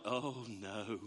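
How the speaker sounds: low, soft, drawn-out mock horror; acted sinking realization; hushed dismay. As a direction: sink into a quiet groan of dread. Acts dismay at breaking an heirloom.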